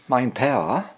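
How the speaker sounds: ordinary talk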